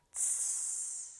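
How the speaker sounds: soft hiss of a Scouse T; fricative T hiss. Shown as a demonstration. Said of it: "it vibrates like this".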